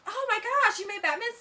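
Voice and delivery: put-on high, loud Valley girl outrage. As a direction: shriek it like an indignant Valley girl. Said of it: "oh, I sound like a total Valley girl".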